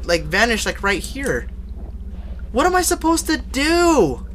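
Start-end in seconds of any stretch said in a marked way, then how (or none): 2.5-4.2 high, drawn-out helpless plea; stretched-out, high-pitched despair; panicked whine. Real, not acted. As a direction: wail it slowly in dismay. Stuck and scared in game.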